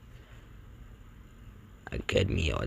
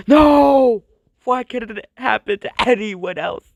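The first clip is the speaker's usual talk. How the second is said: high, loud, hammed-up heartbreak; exaggerated crying protest, easing toward the end; mock wailing; 11 semitones higher, 7 dB louder than usual. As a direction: wail it like a melodramatic tearful cartoon. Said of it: "And he's crying".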